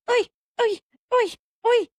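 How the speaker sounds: high, sharp little "oi"; imitated massage yelp. Masseuse working on her friend's back, high yelps. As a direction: mimic the masseuse's high squeals. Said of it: "thought I was making that sound".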